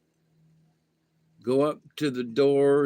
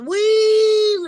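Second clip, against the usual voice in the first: high mock squeal; 9 dB louder than usual. Mimicking girls' scream.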